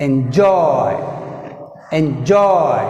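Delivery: Enjoy, weight on Joy; teacher modelling enJOY, stress on JOY. Shown as a demonstration. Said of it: "Joy is second syllable".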